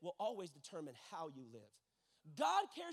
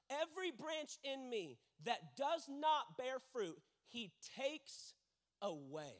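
measured, weighty verse, repeated higher; dramatic scripture reading; 3 semitones higher, slower than usual. Real, not acted. Preacher reading the problem verse to the congregation, dramatic scripture reading. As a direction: read it out slowly with heavy pauses.

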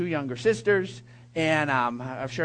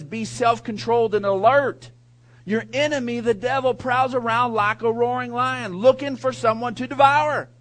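louder, raised scripture read; 5 semitones higher, 5 dB louder than usual. Acted. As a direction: proclaim the verse to the congregation. Reading scripture aloud.

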